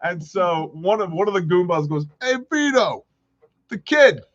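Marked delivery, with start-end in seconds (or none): 2.2-4.2 high wiseguy approval; lightly hammed goon talking to his boss; mock mobster. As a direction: vouch for the kid like a goon. A mobster praises the kid.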